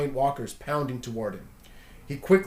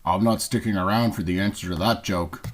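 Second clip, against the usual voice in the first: much lower, unsure alien voice; 7 semitones lower than usual. Acted. Alien admits not knowing the answer, low and unsure.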